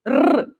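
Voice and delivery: "R" as a tongue-tip trill; rolled rrr alone for "R"; single R sound. Shown as a demonstration. Teacher isolating the R between vowels, rolled rrr. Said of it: "só um R".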